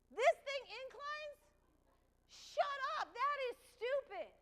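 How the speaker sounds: high, slow disbelieving; incredulous. Retold or imitated. Contestant scoffing to Joe about incline, incredulous. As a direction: scoff at the news. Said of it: "I'm like".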